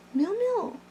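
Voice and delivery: high cooing call to a pet; pet talk. Real, not acted. Calling to her pet in a high coo.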